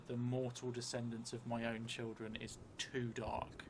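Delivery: ordinary talk, nothing marked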